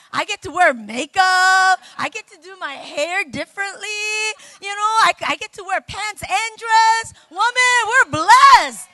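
high, loud rejoicing, building to a shout; exuberant celebration. Real, not acted. Preacher rallies the women about being blessed, exuberant celebration. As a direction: celebrate it loudly, voice lifted high.

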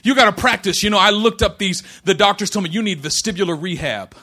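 shouted, high preaching cadence; full-force preaching. Acted. Pastor preaching to congregation, loud and high.

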